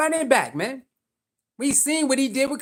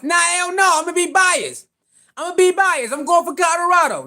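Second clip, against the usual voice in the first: fired up; 8 semitones higher, 3 dB louder than usual. Real, not acted. Openly backing his team.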